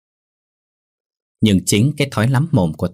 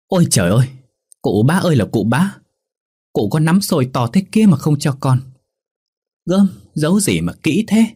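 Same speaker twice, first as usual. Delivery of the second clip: fake lament; 7 semitones higher than usual. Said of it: "giả giọng than trời rồi kêu lên". (giả giọng than trời, kêu lên cao và to)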